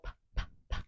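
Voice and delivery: whispered puffs p, p, p; teacher modeling the p sound; letter sound p. Shown as a demonstration. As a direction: puff out the p sounds without voice. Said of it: "What letter is that?".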